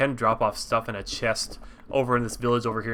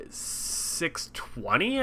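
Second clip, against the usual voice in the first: talking mid-sip, words half muffled; sipping a drink; 4 semitones higher, 8 dB quieter, much slower than usual. Real, not acted. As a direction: say it with a drink at your lips. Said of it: "sipping on a drink over here".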